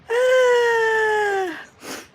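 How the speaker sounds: high, loud crying sigh; dramatic howling sigh; loud wailing sigh. Real, not acted. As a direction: let out a long wailing groan.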